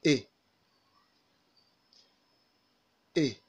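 "E" said as a short, lax "ih"; short vowel demo. Shown as a demonstration. Teacher introducing a new vowel, short "ih" for "E". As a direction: model "E" as the brief vowel in "sit", lips spread. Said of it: "This is pronounced".

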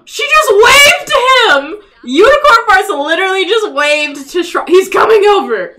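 high, loud gleeful exclaim; excited squeal. Real, not acted. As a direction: squeal with delight.

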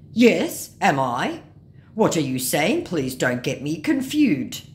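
loud, confused mock scammer voice. Scammer cornered about who he is, mock confusion. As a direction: play a baffled scammer, drawn out at the end.

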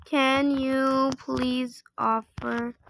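slow, stretched, evenly spaced words; slow dictation. Real, not acted. Player typing an offer request, slow dictation. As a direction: sound out each word while typing. Sounding out a typed message.

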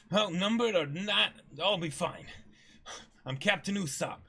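high, loud proud declaration; Usopp boast. Acted. Usopp to the pirates, bragging he is a brave warrior. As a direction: boast bravely over shaking nerves.

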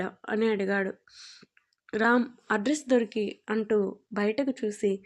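usual speaking voice

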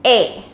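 A said like eh; German Ä. Shown as a demonstration. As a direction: umlaut A as open eh. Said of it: "with umlaut, we are pronounced it as".

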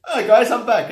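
high, loud, breezy "I'm back"; mock cheerful character. Mocking a movie hero's return, chirpy and loud. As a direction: play it like nothing happened. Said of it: "opens the door".

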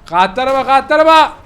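high, loud panicked yelling; panicked shouting. Real, not acted. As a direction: scream at everyone to run. Killer car arrives, fleeing. (panik içinde bağırarak)

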